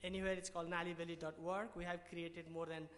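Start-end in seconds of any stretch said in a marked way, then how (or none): none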